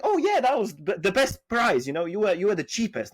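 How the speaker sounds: high-pitched voice imitating the client; pleased customer. Customer tells seller he was cheapest, pleased customer. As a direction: start very high as the happy customer.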